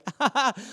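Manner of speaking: high-pitched forced laugh; acted nervous chuckle; awkward laugh.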